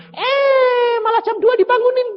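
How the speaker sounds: high, loud, half-crying complaint; exasperated outcry. Retold or imitated. Preacher complaining of being woken at two, high loud wail.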